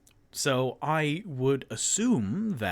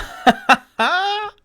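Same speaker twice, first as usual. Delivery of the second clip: bursting out laughing; big high laugh; loud laugh; 13 dB louder than usual. Real, not acted. Laughs at own mistake.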